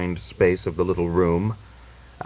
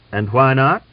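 sharp; 6 semitones higher, 6 dB louder than usual. A narrator's reading. General challenges.

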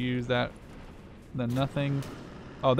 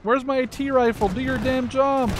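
exasperated yelling; 13 semitones higher than usual. Real, not acted. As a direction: yell at the useless soldiers. Yelling at useless units.

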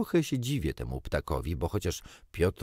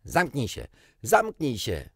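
shouting; 5 semitones higher, 4 dB louder than usual. A narrator's reading. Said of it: "obaj wykrzyknęli".

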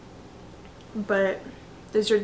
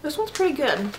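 speaking between bites; talking while chewing. Real, not acted. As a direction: mouth partly full. Seen 1: Lips close and press between words, as in the chewing before.

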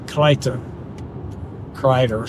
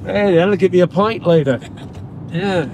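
higher, lilting Irish brogue; playful mock Irish charm; Irish accent; 3 semitones higher than usual. Shown as a demonstration. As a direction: thank the lassie like a jolly Irishman. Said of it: "I got a little Irish in me".

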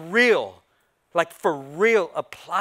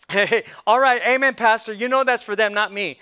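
mock hearty amen; 3 semitones higher, 7 dB louder than usual. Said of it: "how about this one".

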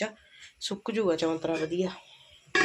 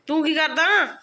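teasing call; 7 semitones higher, 9 dB louder, slower than usual. Real, not acted.

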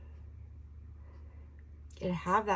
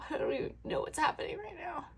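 higher and fast, emotion spilling over; overcome with emotion; 9 semitones higher, 4 dB quieter, faster than usual. Real, not acted. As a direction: let the feeling rush the words out. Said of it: "See, it's hit me again".

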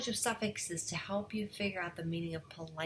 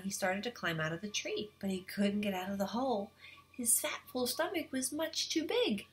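ordinary delivery

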